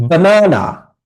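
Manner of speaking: "Banana" with the middle swelling high, then falling; middle-syllable stress. Shown as a demonstration. Teacher showing students where to clap, stressing mid "banana". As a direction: lean hard on the middle of "banana". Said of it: "the stressed syllables".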